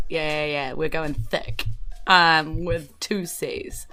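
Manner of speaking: normal talking voice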